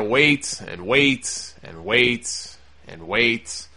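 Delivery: slow, stretched repetitions of WAIT with long gaps; drawn-out waiting. Retold or imitated. Telling listeners how long the wrestlers waited, drawn out. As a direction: drag each WAIT out, low and slow.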